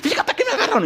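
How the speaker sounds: high, wailing, half laughing through tears; a scared young man blubbering to his relative; panicked crying cousin. Retold or imitated. Jailed cousin pleads with David, high and tearful. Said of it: "sale llorando".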